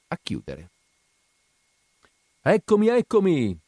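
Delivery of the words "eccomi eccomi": high, loud clown shout. A narrator's reading. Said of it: "gridava la clown".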